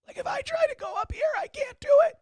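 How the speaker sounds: very high, cracking falsetto; strained high voice. Shown as a demonstration. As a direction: push the voice way up and let it crack. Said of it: "I can't talk in a high voice anymore".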